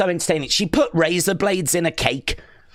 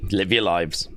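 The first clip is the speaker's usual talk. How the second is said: a hint of a weary older man, lower; lightly voiced Big Jim; 13 semitones lower than usual. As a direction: toss off "Live your lives" lightly, lower. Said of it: "Big Jim backstage right now is like".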